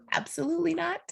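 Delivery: high, tearful voice holding firm; sort of crying. Real, not acted. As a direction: insist through real tears. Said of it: "I'm sort of crying in front of you".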